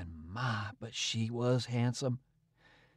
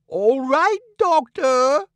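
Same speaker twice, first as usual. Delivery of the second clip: much higher and louder mock echo; 11 semitones higher, 11 dB louder, much slower than usual. A narrator's reading. King mocking the departing doctor, high and loud. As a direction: ape the doctor's words with a sneer. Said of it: "kinda mocking him".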